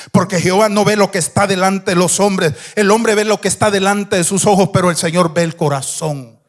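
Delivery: loud chanted preaching over music; preacher rising to a loud chanted climax; full-force preaching. Acted. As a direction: build the climax, shouting.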